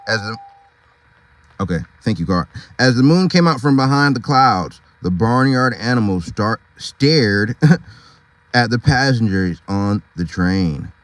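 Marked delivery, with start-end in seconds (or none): none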